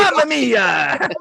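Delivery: mock Italian, laughing through it. Joking after the Irish intro, mock Italian accent. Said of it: "Stereotypical voices".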